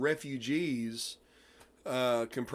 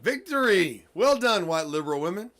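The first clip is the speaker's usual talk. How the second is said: sarcastic cheer; 4 semitones higher, 6 dB louder than usual. Acted. Sarcastic cheer at bad news.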